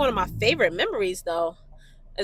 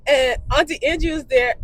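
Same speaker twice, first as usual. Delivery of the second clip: ordinary talk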